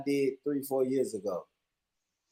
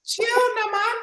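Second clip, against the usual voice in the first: very high squeaky patois; Jamaican patois impression; slower than usual. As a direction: squeak it like her. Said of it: "the way she said it".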